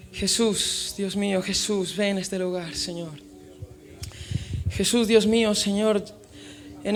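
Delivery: soft, murmured prayer to Jesus; hushed prayer. Acted. Pastor quietly calling on Jesus with the congregation, hushed prayer. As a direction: pray under your breath, drawing close.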